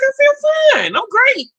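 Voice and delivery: loud, high-pitched retold exclamation; squealed mock reassurance; exaggerated insistence. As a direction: belt it out as if to convince everyone. Exaggerated retold claim of feeling fine.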